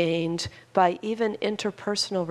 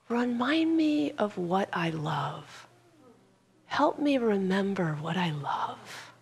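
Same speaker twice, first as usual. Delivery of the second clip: put-on frail elderly woman; 5 dB quieter than usual. Old mother touching her daughter's hand, frail and soft. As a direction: gently plead as an old mother. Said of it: "the frail elderly woman".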